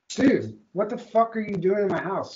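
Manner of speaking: loud, high retold outburst at the intruder; a hint of the mad yell, relived for the story; angry yell. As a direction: yell it like you caught someone in your house. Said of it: "I was so mad".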